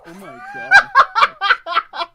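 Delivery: very high, loud squeal of laughter; helpless laughter. Real, not acted. Reacting to a co-host's impression, laughing helplessly. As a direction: barely get it out for laughing.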